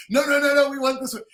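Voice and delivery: high, loud mimicked insistence; eager insistence. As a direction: overrule him excitedly, pitch way up. Acted insistent publishers overruling him.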